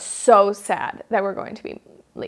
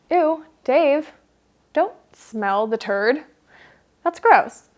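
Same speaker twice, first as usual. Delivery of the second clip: disgusted; 5 semitones higher, slower than usual. Retold or imitated. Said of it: "My initial reaction is like".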